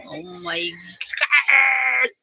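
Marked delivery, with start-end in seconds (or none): 1.2-2.1 sudden louder, higher cry; overwhelmed yell of emotion; emotional cry-shout. Real, not acted. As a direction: break out in a choked shout. Overwhelmed by viewers' support.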